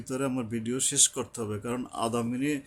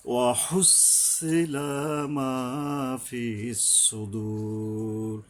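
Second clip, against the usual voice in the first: slow, drawn-out chanted recitation; Quran recitation; much slower than usual. Shown as a demonstration. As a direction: intone each word, stretching the vowels. Recites the verse.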